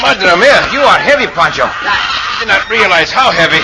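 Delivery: loud, strained with laughter; straining. Acted. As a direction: heave and gasp while pulling. Cisco hauling Pancho up on rope.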